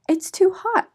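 a bright caring mother bear warning; Mama Bear voice. Acted.

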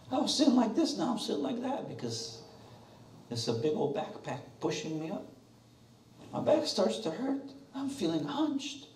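high nasal-free whine of an uncomfortable flyer; whiny complaining passenger. Pretend. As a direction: moan like a fed-up passenger stuck with a heavy pack. Whiny passenger complains about parachute.

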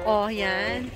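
ordinary talk, nothing marked

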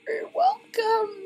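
slow, high little-creature thanks; high, slow squeaky voice. Pretend. Voicing a character thanking.

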